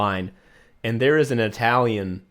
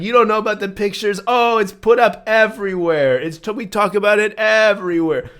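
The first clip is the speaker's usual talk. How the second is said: higher, louder, fast sarcastic clerk; mock sarcastic teller; 9 semitones higher, 7 dB louder than usual. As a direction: rub it in like it's obvious. Rude teller mocking tourists, voiced.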